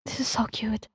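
soft whispered gush; mushy husband whispering over the laptop; blabbering whisper. Acted. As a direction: whisper it, overcome and teary. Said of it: "while he blabbers on".

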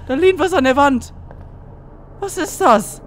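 loud, high-pitched alarm; real shocked outburst; startled shout. Real, not acted. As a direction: yell it in sudden fright. Spots figure leaning on wall.

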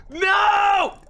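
long, high, loud wail; anguished shout. Real, not acted. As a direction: howl in dismay. Loses ground, shouts.

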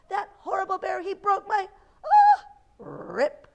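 high, loud rant building to a shriek; little girl losing her temper; angry girl's outburst. A narrator's reading. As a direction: work up to a frustrated scream. Girl rants, rips teddy.

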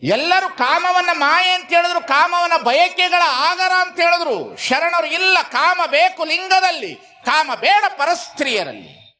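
high, ringing sermon delivery; impassioned preaching. Real, not acted. Preacher tells the congregation what the sharanas taught, high impassioned preaching. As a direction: proclaim it high to the whole crowd.

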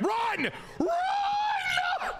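very high, screamed urging; urgent yell. Real, not acted. Gamer urging his runner home, high frantic scream. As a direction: scream the runner on, stretching the last word.

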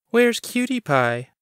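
a chirpy clerk teasing a regular; Bonnie the clerk. A narrator's reading. Coffee shop clerk asking Chris about Owen, high teasing voice.

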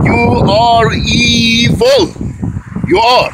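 slow, high, drawn-out condemnation; real emphatic accusation. Real, not acted. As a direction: stretch each word as you accuse. Accuses the absent person to their face.

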